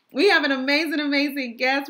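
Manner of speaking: bright, loud restart on "we have an amazing"; excited. Real, not acted. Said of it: "I'm so sorry, I got this gum".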